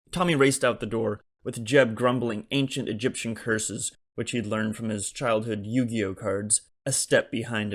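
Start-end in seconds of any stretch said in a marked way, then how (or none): none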